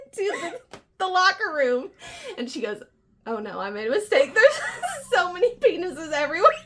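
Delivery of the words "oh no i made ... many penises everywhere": hurried start rising into high, laughing dismay; laughing mock dismay. Friend retelling her walk into the locker room, laughing mock dismay. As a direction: act out the mistake, breaking up laughing.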